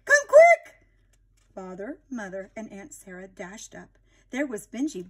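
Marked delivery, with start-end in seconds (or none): 0.0-0.8 urgent call. Acted. Children urge parents upstairs.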